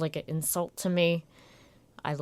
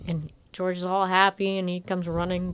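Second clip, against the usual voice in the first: normal talking voice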